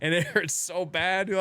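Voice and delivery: ordinary talk, nothing marked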